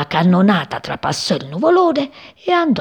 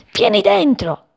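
much higher-pitched urging; high, insistent character voice; urging mother-bird figure; 13 semitones higher than usual. A narrator's reading. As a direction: urge them inside in a high voice. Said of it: "gli raccomandò Passerì".

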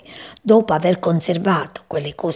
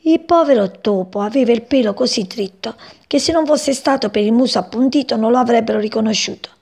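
usual speaking voice

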